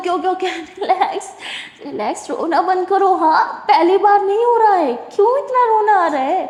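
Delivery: high, amused coaxing to stop crying; laughing reassurance. Acted. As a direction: chuckle and calm her. Jaz calming crying Sara.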